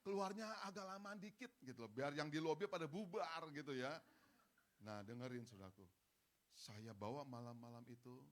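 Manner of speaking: normal talking voice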